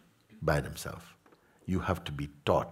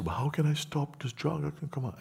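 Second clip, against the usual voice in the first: higher, imitating a troubled seeker; pleading seeker; 9 semitones higher, 3 dB quieter than usual. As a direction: ask like a lost student begging for help. Voicing a seeker's question.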